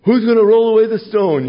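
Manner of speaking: high, loud fretting voice; mock-worried cry, high and loud; worried women. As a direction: fret aloud like the anxious women.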